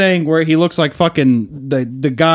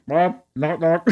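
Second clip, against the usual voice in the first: muffled from the basement; muffled as if behind a wall; 6 dB quieter, much slower than usual. Retold or imitated. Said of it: "I hear muffled".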